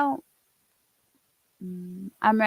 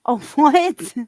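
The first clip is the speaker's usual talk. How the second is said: laughing surprise; 4 semitones higher, 10 dB louder than usual. Real, not acted. Laughing at video reveal.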